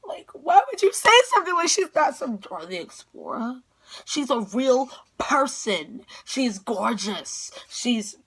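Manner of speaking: high, loud protest slowing for emphasis; hammed-up offended fan; retold indignant defense. Fan to stepdad defending her idol, indignant.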